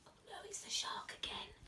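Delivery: put-on hushed, fearful whisper to the children; scared whisper. A narrator's reading. Teacher reading to class, whispering in alarm. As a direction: breathe it like the shark might hear.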